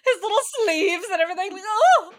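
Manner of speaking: high, loud and fast, giddy; excited. Real, not acted. Gushing over the hero's little sleeves. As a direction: squeal it with delight.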